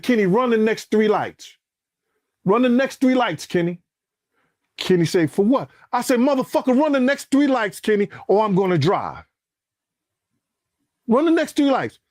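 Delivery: urging the driver to speed through lights; retold urgent order. Ordering driver during shooting.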